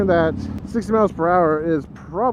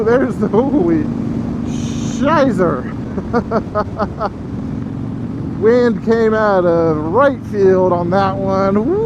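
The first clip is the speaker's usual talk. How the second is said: excited; 4 semitones higher, 4 dB louder, slower than usual. Real, not acted. Wind gust hits at speed.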